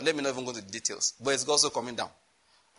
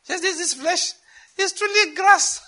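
laughing; 5 dB louder, slower than usual. Acted. Preacher laughing at lost money.

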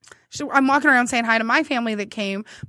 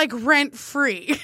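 drawn-out rent free; much slower than usual. Retold or imitated. Own retort drawn out.

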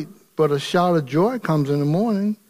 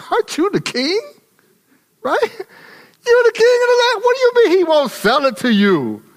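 mocking Jezebel; 14 semitones higher than usual. Jezebel taunting her sulking husband, high and mocking. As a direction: goad him like a scornful wife.

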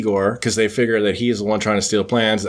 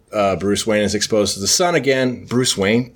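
ordinary talk, nothing marked

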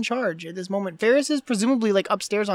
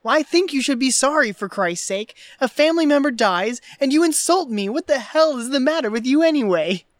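raised, louder scolding as Rooney; mock indignant scolding; 5 semitones higher, 5 dB louder than usual. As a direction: tell him off loudly for the insult. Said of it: "It's like".

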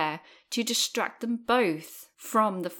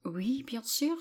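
soft, higher-pitched French reply; speaking French; 4 semitones higher, 5 dB quieter, slower than usual. Shown as a demonstration. Asked to speak some French, softly speaking French. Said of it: "Can you speak some French for us".

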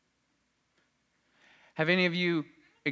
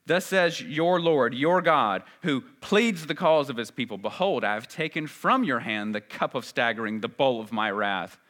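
scripture reading; 5 dB louder than usual. Acted. Reads Isaiah aloud with force.

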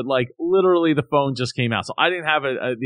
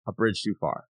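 bridge said softer, falling; soft repeat; 4 semitones lower, 7 dB quieter than usual. Real, not acted. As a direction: drop the voice on bridge. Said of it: "repeats phrase, drops soft".

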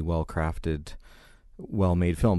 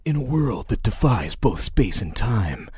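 movie trailer announcer; 3 semitones higher, 5 dB louder than usual. Retold or imitated. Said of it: "the announcer voice".

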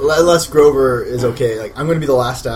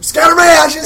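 loud, high hammed-up goodbye; melodramatic farewell shout; 13 semitones higher, 6 dB louder than usual. Fleeing brother shouts a hammed-up goodbye to his sister, loud and high. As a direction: shout it like a tragic last farewell.

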